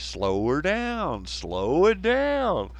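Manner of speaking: slow, stretched-out drawl; exaggerated slow-down. Southerner to fast-talking Northerners, exaggeratedly slow. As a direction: draw out each word in long swoops. Mocking fast talkers, asks for slowness.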